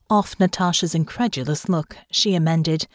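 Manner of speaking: usual speaking voice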